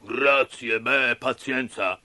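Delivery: slow ogre voice, resigned; relenting puppeteer. Acted. Puppeteer thanking Pinocchio after sneezing, resigned. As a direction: give in grudgingly as a big ogre. Mangiafuoco figure.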